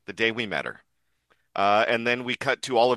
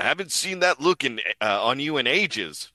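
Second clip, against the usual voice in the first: raised, put-on character voice; Frankie; 6 semitones higher than usual. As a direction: pitch it up as a character line. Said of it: "And Frankie in".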